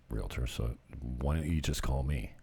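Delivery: usual speaking voice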